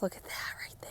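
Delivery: soft hushed whisper over the buds. Real, not acted. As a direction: lean in and whisper it. Showing rosebuds up close.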